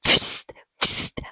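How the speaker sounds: sharp hissed spurt sounds; two short voiceless spurts; imitated squirting noise. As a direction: mimic liquid squirting out in bursts. Imitates blood squirting from toe.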